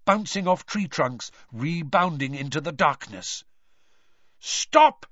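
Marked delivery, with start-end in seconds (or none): 4.4-5.0 high, loud yell. Acted. Father yelling at the wizards, yelled. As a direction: yell to halt the attack. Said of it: "yelled a voice he recognized".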